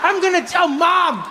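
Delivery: put-on high whiny kid complaint; whiny angry kid. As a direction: whine like an upset child. Kid scolding dad.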